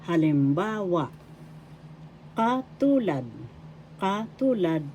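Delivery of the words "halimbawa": Halimbawa, stressing a lengthened BA; halimBAAwa, third syllable held. Shown as a demonstration. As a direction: stretch BA in Halimbawa for learners. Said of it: "Third syllable has the accent".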